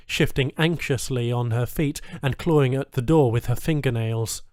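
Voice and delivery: plain reading voice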